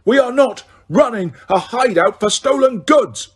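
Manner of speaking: high, loud furious yell; mother raging at stolen goods; shouting at the top of her voice. A narrator's reading. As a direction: bellow it like an outraged mother. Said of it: "shouting at the top of her voice".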